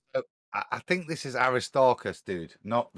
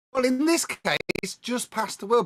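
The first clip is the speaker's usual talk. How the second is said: emphatic correction; 13 semitones higher than usual. Acted. Correcting title, voiced higher.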